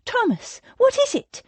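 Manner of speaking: high, startled woman's voice; alarmed woman. A narrator's reading. Rose running to Thomas, alarmed.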